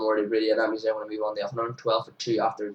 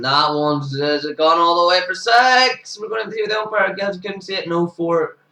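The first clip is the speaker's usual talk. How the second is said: excited call; 7 semitones higher, 5 dB louder than usual. Real, not acted. Unsure if it's six.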